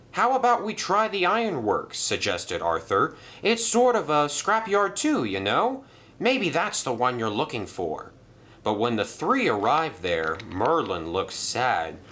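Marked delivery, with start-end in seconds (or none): 0.1-8.1 much higher, eager suggestion; helpful engine Arthur. Acted. Arthur suggesting the ironworks to Merlin, higher and eager. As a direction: offer another idea.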